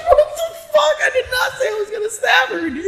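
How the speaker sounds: high, loud shout of disbelief, half-laughing; retold outraged shout. Telling friends how he denied the stab threat, a laughing outraged shout. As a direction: blast the denial out, laughing.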